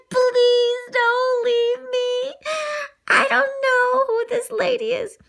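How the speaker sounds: high, slow little dog begging; small dog pleading high and slow; pleading puppy. Acted. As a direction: beg your owners not to go.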